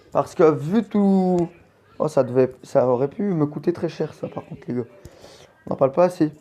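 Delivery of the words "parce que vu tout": ordinary talk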